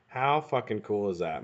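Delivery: ordinary delivery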